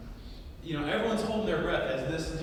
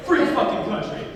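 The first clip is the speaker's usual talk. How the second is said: loud, raised-pitch mock defiance; quoted angry protest; 7 semitones higher, 5 dB louder than usual. Comic repeats the passenger's classic line, mock indignant. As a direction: punch out the indignant catchphrase. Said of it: "Classic line".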